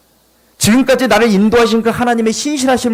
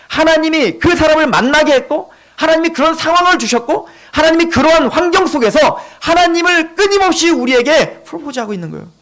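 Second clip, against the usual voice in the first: high, driving sermon voice; fervent preaching; 6 semitones higher than usual. Real, not acted. Preacher tells congregation how God pursues them, fervent preaching. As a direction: press each point on the congregation, pitched high.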